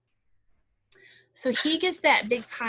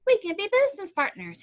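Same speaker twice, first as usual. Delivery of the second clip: high, louder hare pitch; eager hare; 10 semitones higher, 7 dB louder, faster than usual. A narrator's reading. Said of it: "Hare said".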